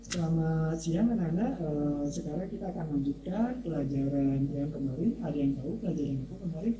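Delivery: low, flat-toned classroom opening, fast at the end; lightly mock the boring teacher; a hint of a dull teacher. Shown as a demonstration. Teacher to class, lightly mocking a dull greeting.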